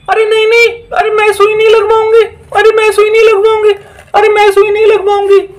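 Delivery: a witch begging not to get the shot; protesting witch. Acted. Second witch refuses injection.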